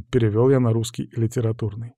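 ordinary delivery, nothing marked

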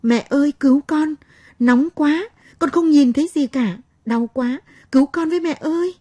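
put-on high, loud wailing child's cries; a scalded little girl sobbing for help; child crying for mother. A narrator's reading. Blinded little girl wailing to her mother, crying in pain. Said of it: "nó kêu khóc gọi mẹ".

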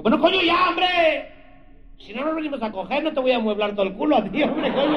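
high, loud exasperated mock shout; hammed-up angry Castilian rant; fed-up Spaniard. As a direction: blow up like the annoyed Spanish lover. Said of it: "se encabronó".

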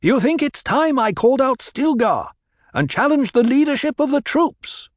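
Paul raising his voice to the crowd. A narrator's reading. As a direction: throw the question at them. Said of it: "Again Paul raised his voice".

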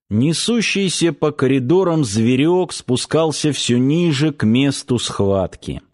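ordinary delivery

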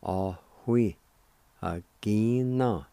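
ahui agina, said slowly, syllables drawn out; Cherokee word for kid. Shown as a demonstration. Teacher sounds out ahui agina for learners. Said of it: "And together".

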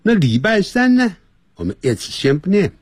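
ordinary talk, nothing marked

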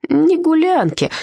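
put-on slurred drunk voice, slow; town drunk. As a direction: slur it like a tipsy husband. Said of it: "заплетающимся языком ответил Витя".

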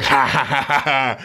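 low chuckling ha ha; a satisfied, knowing laugh; drunk man's laugh. Retold or imitated. Drunk old man laughing as the coffin rises, low chuckle. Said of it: "ông cười".

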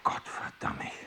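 whispered oath under the breath; stunned whisper. Acted. Teacher whispering in shock at the boy's sum. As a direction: barely voice it, thrown by the answer.

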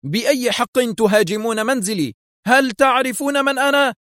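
very high-pitched outraged protest; acted high-voiced outburst; indignant cry. A narrator's reading. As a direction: demand it shrieking high, without much extra volume. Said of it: "على حين صاح جوزيف".

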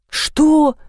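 high, loud, startled what; bewildered. A narrator's reading. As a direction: caught off guard, blurt it. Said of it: "растерялся майор".

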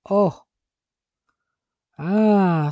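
Oh, Ah lancés plus haut et plus fort; s'exclamer, stupéfait et rageur; exclamation étonnée. A narrator's reading. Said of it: "s'exclama-t-il". (exclamation stupéfaite)

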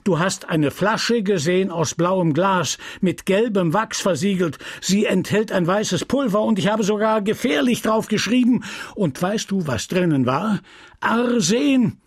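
the pharmacist fuming at his apprentice; a lightly voiced furious old apothecary; angry apothecary. Homais interrogating Justin.

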